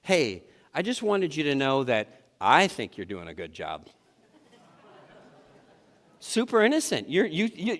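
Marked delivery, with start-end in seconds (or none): 0.0-3.9 undercut the praise on the last word; sarcastic compliment. Shown as a demonstration. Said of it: "you can use this tomorrow".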